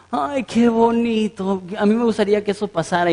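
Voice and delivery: hammed-up swooning over something touching; mock sentimental gushing. Preacher mimicking a tearful TV viewer, mock sentimental gushing. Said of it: "están llorando, y dices".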